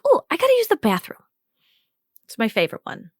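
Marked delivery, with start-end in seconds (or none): 0.0-1.2 high, light fake excuse. Shown as a demonstration. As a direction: chirp an excuse upward. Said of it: "you can always just be like".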